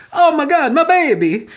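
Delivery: high, hammed-up Italian wail; mock Italian accent. Commentator imitates a parent finding the baby, in mock Italian accent. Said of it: "I don't know why I went Italian".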